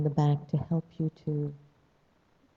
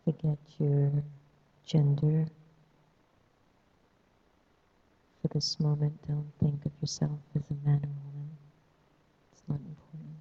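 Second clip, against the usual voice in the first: guided meditation. Acted. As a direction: ease them into stillness.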